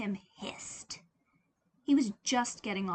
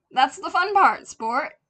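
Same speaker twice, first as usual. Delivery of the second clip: hearty father; 8 semitones higher, 15 dB louder than usual. Acted. Hearty father shows find.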